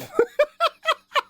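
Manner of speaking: high incredulous laugh; burst of laughter. Real, not acted. Streamer told demos end soon, disbelieving laugh.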